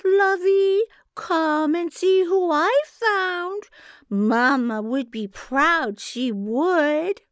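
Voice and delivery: high, loud, slow syrupy coaxing; sweet giantess. A narrator's reading. Giantess coaxing brother Conrad, high syrupy sweetness. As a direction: purr like a doting big sister. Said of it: "that strange, sweet voice".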